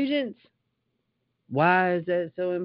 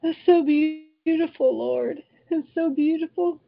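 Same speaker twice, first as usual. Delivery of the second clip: near tears; 8 semitones higher than usual. Real, not acted. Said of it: "Oh, I'm about to cry".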